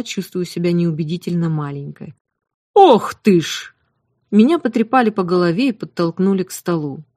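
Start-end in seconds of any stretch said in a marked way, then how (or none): 2.7-3.7 drawn-out surprised exclamation. A narrator's reading. Cook exclaims at small girl.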